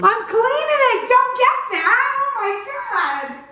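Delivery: high-pitched exasperated squeal of protest; flustered protest. Pretend. Host caught cleaning up, high flustered protest.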